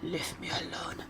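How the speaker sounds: whispered, fed-up brush-off; tired whispered ugh; weary groan. Retold or imitated. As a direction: sigh it out under your breath. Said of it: "And he's like".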